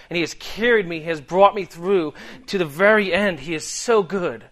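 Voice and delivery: ordinary talk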